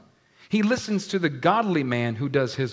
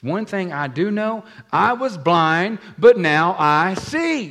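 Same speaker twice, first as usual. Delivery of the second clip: slow, loud, higher, each word hammered; righteous anger, spelled out slowly; angry; 5 semitones higher, 9 dB louder, slower than usual. Acted. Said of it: "He's getting angry now".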